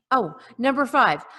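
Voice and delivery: ordinary talk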